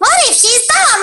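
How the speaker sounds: very high, airheaded chicken squeak; dumb chicken voice. Shown as a demonstration. Pitching her director a dumb chicken, squeaky high. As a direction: pitch it way up like a clueless hen. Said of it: "then I'm like".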